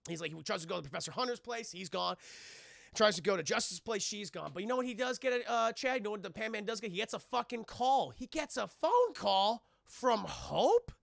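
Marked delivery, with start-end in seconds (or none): none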